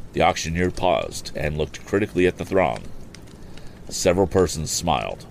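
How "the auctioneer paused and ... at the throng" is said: ordinary delivery, nothing marked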